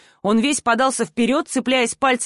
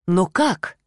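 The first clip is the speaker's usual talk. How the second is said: ordinary delivery, nothing marked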